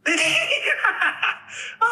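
very high evil cackle; villain laugh. Acted. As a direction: cackle before the threat. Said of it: "with the laughter at the end".

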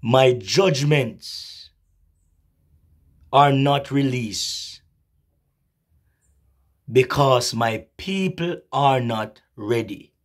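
put-on drawn-out, weighty old man's warning; slow solemn prophecy. The old man warns the girl, slow and grave. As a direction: let each word fall slowly.

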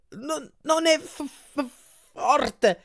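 very high, slow, juddering voice; vibrating moan. A narrator's reading. Grover moans while a million hands massage him, voice vibrating. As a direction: stutter it high as if shaken by a massage machine. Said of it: "la voce che vibrava per via del massaggio".

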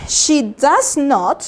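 She does not said slowly, every word separate; slow full form. Shown as a demonstration. Teacher showing students the full form, very slow. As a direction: lay out the long form slowly.

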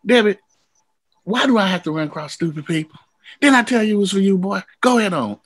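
high, mock-exasperated big friend; exasperated James. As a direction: play him fed up but kind. James voiced, exasperated, retold.

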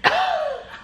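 squealing disbelieving laugh; high giggle of shock. Real, not acted. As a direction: let out a high startled laugh. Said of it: "I have never gasped such a gasp".

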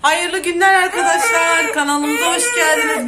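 loud, high welcome shouted to the channel; loud greeting. Real, not acted. Vlogger greets her channel with a loud, high shout. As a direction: call out a big hello to viewers. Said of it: "Çok bağırarak konuşuyorum".

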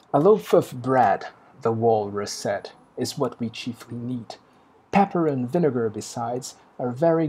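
slow English verse read with weight on loaf; poem recital. Acted. Teacher reading the Walrus's lines to the class, slow recital.

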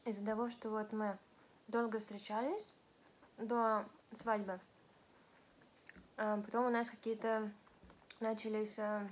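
speaking with food in mouth; partly full mouth; chewing while talking. Real, not acted. Seen 3: Bites sushi at -5; cheeks bulge while talking throughout the words.

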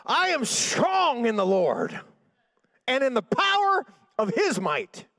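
high, slow, drawn-out shout; screaming. Retold or imitated. Revived sister up after half an hour, screaming her declaration. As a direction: imitate a full-voiced triumphant scream.

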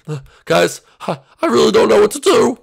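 high, loud worried wail; panicked helplessness. Acted. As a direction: sound lost and overwhelmed. Still panicking about the attack.

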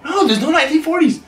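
high, fast squeaky voice; squeaky denial. Acted.

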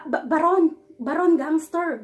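ordinary delivery

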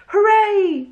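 high, loud hooray; joyful shout. A narrator's reading. Charlie cheering on seeing the playground. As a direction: let the cheer burst out. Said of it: "shouted Charlie".

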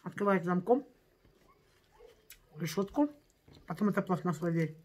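chewing while talking; muffled through food; mouth full. Real, not acted. Seen 2: Bite of rice at -2; lips closed, cheeks working during words.

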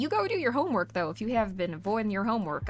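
usual speaking voice

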